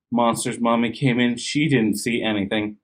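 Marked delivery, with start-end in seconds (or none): none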